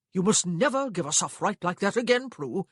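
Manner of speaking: loud, high scolding; brotherly warning. A narrator's reading. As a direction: scold her like a stern brother. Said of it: "his brotherly warning".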